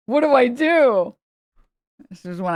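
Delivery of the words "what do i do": louder, drawn-out frightened plea; a scared child frozen over spilled milk; mock panic, slowed and raised; panicked kid. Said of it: "I was so stressed in that moment".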